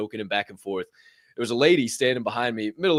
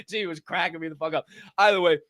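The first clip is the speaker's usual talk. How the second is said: normal talking voice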